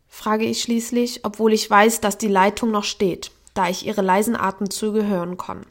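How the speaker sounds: usual speaking voice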